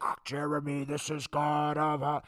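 slow, booming mock God voice. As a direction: kids pranking Jeremy through the teacher's mic. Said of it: "get on the microphone and be like".

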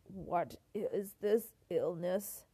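slow, croaky voice; ailing king's laboured croak; croaking sick king. A narrator's reading. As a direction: croak it out weakly, word by word. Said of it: "He croaked".

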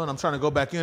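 ordinary delivery

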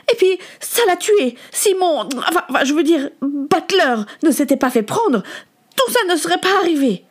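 put-on high-pitched, bitter tirade of a hurt boy; heated, accusing rant; furious outburst. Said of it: "La fureur que Samuel".

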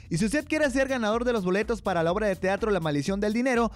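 upbeat radio announcer voice; bright call to listeners to phone in; cheerful host read; radio host announcing. Acted. Host returns from radio drama.

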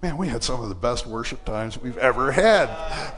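usual speaking voice

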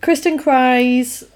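ordinary delivery

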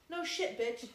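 normal talking voice

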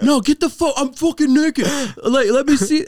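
high, loud panicked shouting; frantic yell. Retold or imitated. Caught naked, friend yelling to get out, frantic. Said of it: "He's like".

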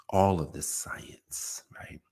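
soft breathy half-whisper; a whispered aside. Real, not acted. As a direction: drop to a whisper mid-thought. Unvoiced aside.

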